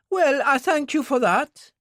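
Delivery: the worried wife asks after Jenny; Mary, worried wife. Acted. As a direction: voice a worried mother figure asking. Voices Mary, grateful.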